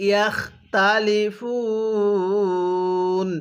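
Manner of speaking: slow, drawn-out Arabic recitation; ধীরে তিলাওয়াত; slow Quran recitation. Shown as a demonstration. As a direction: recite each syllable slowly for the student. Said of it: "এরকমভাবে শিখব।".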